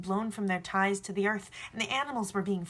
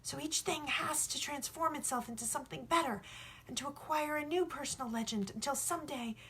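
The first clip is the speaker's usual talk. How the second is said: put-on higher, eager young shepherd explaining; young boy, earnest; 6 semitones higher than usual. The boy tells the sun about love, earnest and higher. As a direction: press the point as a sincere boy.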